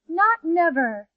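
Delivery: stubborn refusal. Acted. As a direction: refuse word by word. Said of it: "said the rays".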